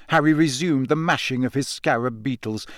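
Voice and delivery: ordinary delivery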